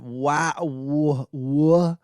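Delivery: lost for words, only W sounds; stunned W sounds. Retold or imitated. Told no laptop at brunch, stammering W sounds. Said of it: "just a bunch of W sounds".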